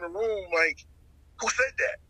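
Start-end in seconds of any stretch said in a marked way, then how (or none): 1.4-2.0 startled. Retold or imitated. Startled by an unseen voice.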